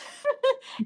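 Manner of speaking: a high, bright burst of real laughter; real amused laugh. Real, not acted. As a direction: laugh freely at yourself. Laugh after her story.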